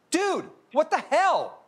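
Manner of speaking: high, drawn-out indignant protest; mock outrage. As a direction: confront him in put-on, high-pitched disbelief. Finds Guillermo took his seat, mock outrage.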